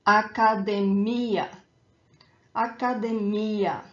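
academia as a-ca-de-MI-a; stress on final ia. Shown as a demonstration. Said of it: "is that ia at the end".

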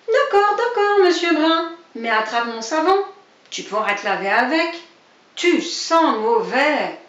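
high, loud, cheeky voice; cheeky neighbour mocking monsieur Brun; mocking rabbit. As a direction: taunt the grumpy neighbour. Other rabbit mocking reply.

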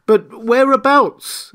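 high, loud, drawn-out question; exasperated interruption. A narrator's reading. Marvel interrupting the invisible man, exasperated. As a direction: break in, stretching out the question. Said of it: "interrupted Mister Marvel".

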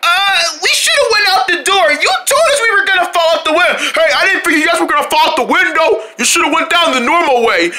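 high, agitated scolding; indignant complaint. Acted. Friends argue after falling from the window, high agitated complaint.

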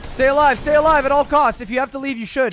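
high, loud urgent callout; urgent shouting. Real, not acted. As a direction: yell it like his life depends on it. Urgent callout to dying teammate.

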